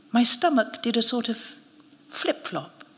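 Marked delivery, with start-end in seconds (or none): none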